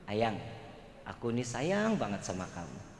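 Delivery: whisper-soft sweet nothings to your husband; showing the audience how a wife should speak lovingly; loving murmur. Shown as a demonstration. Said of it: "kalau ngomong nggak love".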